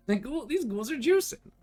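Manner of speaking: high, excited outburst; playful exclamation. Pretend. Player reacting to juiced up ghouls, playful exclamation. Mock alarm at game foes.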